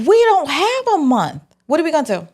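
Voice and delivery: high-pitched incredulous protest; mock alarm. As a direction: act out disbelief at the deadline. Reacting to the Connect's month deadline.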